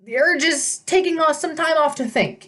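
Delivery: put-on high, nervous old satyr; a shaky brave front; panicky satyr. A narrator's reading. Satyr voice continues.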